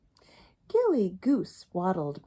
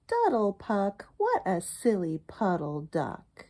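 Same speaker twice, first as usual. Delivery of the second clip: plain reading voice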